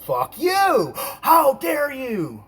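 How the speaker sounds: high, drawn-out mock yell; retold yelling. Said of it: "she keeps yelling at me".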